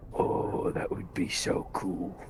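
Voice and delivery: hushed, whispered delight; awed whisper. Acted. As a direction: whisper it, thrilled by the idea. Awed reaction to the cup idea.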